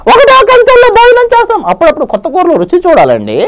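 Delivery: very high coy flirting woman voice; Chammak Chandra lady getup. Retold or imitated. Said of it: "ఆయన voice తీసుకుంటే ఎలా ఉంటుంది". (చమక్ చంద్ర lady getup లో వయ్యారంగా ఎత్తైన గొంతు)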